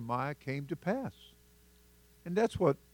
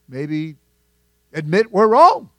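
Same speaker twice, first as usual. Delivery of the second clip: loud rising challenge; 2 semitones higher, 13 dB louder, slower than usual. Acted. Preacher mocks pride.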